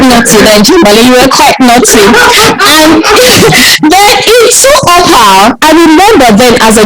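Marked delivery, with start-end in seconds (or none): 3.1-3.9 laughing burst. Real, not acted. Laughing at memory.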